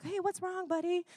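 put-on high, gentle voice of a grown man soothing a boy; Adam Sandler comforting a kid. Adam Sandler to the embarrassed boy, high and gently coaxing. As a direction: lift the voice way up and coax softly. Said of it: "Adam Sandler comes up".

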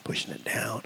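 dropped to a near whisper; hushed. Retold or imitated. Preacher recalling suppressed grief at a funeral, hushed. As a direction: push the words down under your breath. Acts out stuffing feelings down.

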